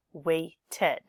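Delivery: "waited" split as wai-tid with a gap; extra id syllable. Shown as a demonstration. Teacher contrasts it with wait, "waited" gaining a separate id syllable. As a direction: sound out "waited" in two parts. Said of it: "always adds an extra syllable".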